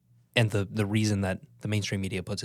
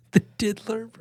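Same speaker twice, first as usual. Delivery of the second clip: laughing; 13 semitones higher, 5 dB louder than usual. Real, not acted. Cracking up at joke.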